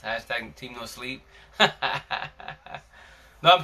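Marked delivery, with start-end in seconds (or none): 1.6-2.8 amused laugh. Real, not acted. Joking about hashtag team no sleep, amused laugh. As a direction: laugh at your own joke.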